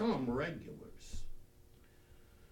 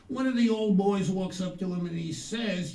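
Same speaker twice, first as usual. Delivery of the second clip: normal talking voice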